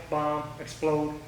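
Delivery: ordinary talk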